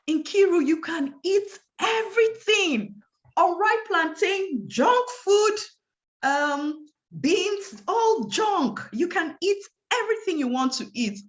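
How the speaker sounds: high, bright, exclaimed list of foods; excited good news. Retold or imitated. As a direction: announce it like wonderful news to a child. Doctor joyfully announcing good news.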